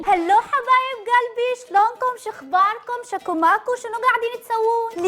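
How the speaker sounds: cheerful Iraqi welcome in put-on dialect; تحية باللهجة العراقية. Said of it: "عايزاني اتكلم عراقي".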